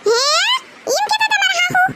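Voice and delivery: can't believe what she's hearing; daughter-in-law hearing the mother-in-law's gossip, shocked; shocked disbelief. Acted.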